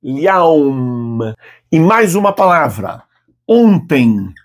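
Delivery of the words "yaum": "yaum" ending on a clear closed-lip M; Arabic M with lips closed; close lips for M. Shown as a demonstration. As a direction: press the lips shut on the final M of "yaum". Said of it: "Fecha os lábios e pronuncia o M".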